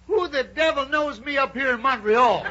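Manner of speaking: high, loud, baffled holler; astonished shout. Acted. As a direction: shout back in comic disbelief at being recognized. Startled Sam answers the holler.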